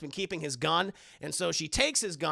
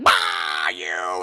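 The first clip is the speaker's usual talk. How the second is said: low and loud, growled shout; deep monster shout; 13 semitones lower, 8 dB louder than usual. Retold or imitated. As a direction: roar it like a little monster shoving someone. Esther shoves a girl.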